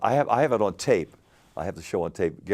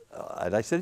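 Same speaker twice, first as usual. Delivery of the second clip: normal talking voice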